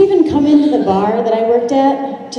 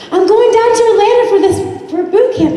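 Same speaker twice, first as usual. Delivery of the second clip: much higher, bright excitement; bubbly mentor; 13 semitones higher, 3 dB louder than usual. Retold or imitated. Sarah excitedly announcing her Atlanta trip, much higher. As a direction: sell it like the best news.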